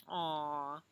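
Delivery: ordinary delivery, nothing marked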